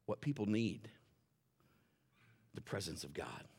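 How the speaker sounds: ordinary talk, nothing marked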